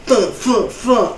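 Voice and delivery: high, short repeated thunk noises; imitated thunks. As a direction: voice the hits as quick high thunks. Imitates the fighter's weapon noises.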